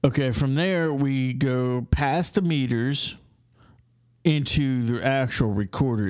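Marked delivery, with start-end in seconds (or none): none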